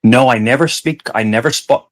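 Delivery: ordinary delivery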